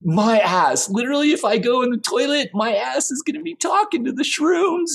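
climbing into a high, frantic pitch; worked-up rant. Acted. Singer rants alone about shrooms.

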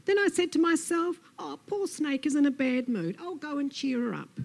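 high, sympathetic storybook lizard; well-meaning Lizard. Acted. Lizard explains wanting to cheer up Snake, fussily sympathetic. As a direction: pitch it up and fuss over poor Snake.

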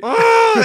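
very high, loud crying oh; hammed-up kid bursting into tears; imitating a kid's wail; mock wail.

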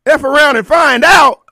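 loud, high, shouted out; big shouted reveal; shouted punchline. Acted. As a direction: belt the punchline at the crowd.

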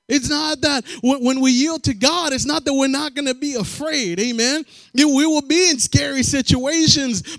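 loud, rising preacher cadence; loud preaching to the church; full-force preaching. Acted. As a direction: drive the point home to the congregation.

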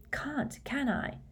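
soft, slow, grim retort; grimly resigned, high and soft. A narrator's reading. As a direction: answer grimly, quiet and drawn out. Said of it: "said Harry grimly".

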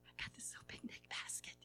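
breathy frightened whisper, quick; timid whisper. Acted. Shaking boy whispering to Jesus. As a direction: whisper it like a scared child.

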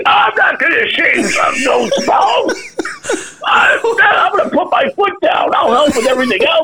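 hammed-up outraged buddy refusing the chore; indignant protest. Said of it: "So he's carrying on".

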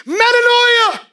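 high, loud cry of metanoia; shouting. Retold or imitated. Peter shouts metanoia to three thousand people, loud. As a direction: Peter preaching full voice to thousands.

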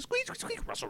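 very high squeaky mouse noises; imitating squeaking mice under the shelves; mouse squeak imitation. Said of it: "All I hear is squeak".

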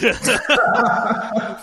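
loud, high burst of laughter; real loud laugh. Real, not acted. Laughing at co-host's dog joke.

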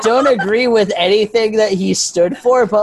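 high, laughing embarrassed admission. Real, not acted. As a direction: laugh through the awkward confession. Laughing at her own Hitler confession.

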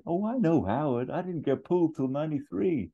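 high, bouncy eager voice; Ferrell impression. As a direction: chirp it out like an excited old-timer. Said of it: "Ferrell's like".

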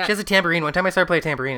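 high, loud excited interjection; manic blurting. Acted. Lake cutting in to boast about Elliot, manic blurting. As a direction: butt in eagerly, words tumbling out.

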